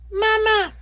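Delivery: very high, piping chick call; baby gosling cry. Acted. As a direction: squeak it like a newborn gosling. Goslings following the bear.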